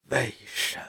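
weak, breathy plea forced from the throat; barely voiced, agonized begging; strained pleading. A narrator's reading. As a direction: squeeze the words out softly, begging. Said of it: "用乞求般的语气艰难地从喉头深处挤出".

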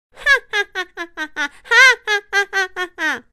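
high maniacal cackle; evil villain laugh. Acted. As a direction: cackle triumphantly. (狂笑)

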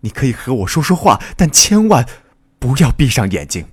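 high, loud pleading shout; urgent shout. A narrator's reading. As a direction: call out to keep someone awake. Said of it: "一个男青年喊道". (高声急切地呼喊)

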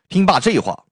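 ordinary delivery

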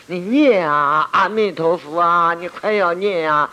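high, loud, dragged-out nagging; mock the clamouring helpers, stretching each ending; noisy mimicked urging. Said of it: "吵死人！".

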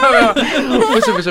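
laughing loud and high, flustered; embarrassed laughter. Real, not acted. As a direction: burst out laughing, then deny it. Embarrassed at producers hearing. (尴尬大笑)

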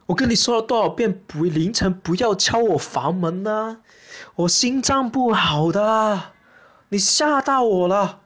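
slow, drawn-out pleading dad; startled father. Acted. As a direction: act a weary father begging his child to stop. Imagined father pleads with child. (拖长声哀求的爸爸)

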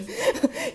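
short high rising giggle; high laugh. Real, not acted. Recalling the buzzing phone to a friend, high giggle. Laugh burst mid-story.

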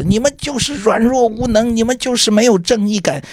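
high, indignant mock lecture; imitating a scolding. Director scolds staff for ignoring fake bills, high indignant mock lecture. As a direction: replay the old man's righteous telling-off, half-hearted. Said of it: "他就骂我们说".

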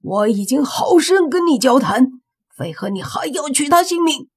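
high, loud, outraged shouting; indignant roar pitched well up; angry shout. A narrator's reading. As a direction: shout in fury at a killer. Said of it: "大喝道".

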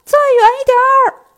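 very high, very loud call from far off. Acted. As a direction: yell it even harder across the distance. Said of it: "又听见孙悟空喊". (更大声地远远喊叫)

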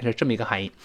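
ordinary delivery

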